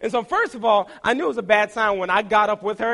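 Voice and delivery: normal talking voice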